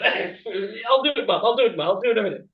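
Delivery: hammed-up frightened kid whine; mock panicked child. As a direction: scramble to obey a scary mom. Child answering goblin-voiced mother.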